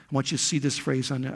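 normal talking voice

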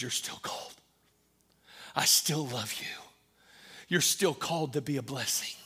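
tender, reassuring. Acted. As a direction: speak it gently, like Jesus to a friend. Tender close after the climax.

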